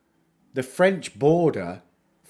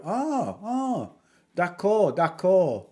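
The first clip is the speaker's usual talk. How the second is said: high, drawn "ah", then French agreement; imitated French official; 7 semitones higher, much slower than usual. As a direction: mimic a Frenchman suddenly catching on. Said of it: "And they go".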